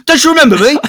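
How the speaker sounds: high, loud mock Cockney plea; Cockney fan. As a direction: whine it like a spurned hanger-on. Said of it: "you go".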